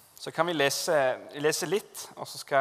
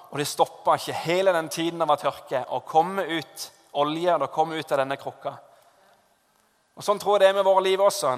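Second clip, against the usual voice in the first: fervent preaching; 3 semitones higher, 4 dB louder than usual. Acted. Preacher urges congregation to trust God, fervent. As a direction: build the sermon to a fervent peak.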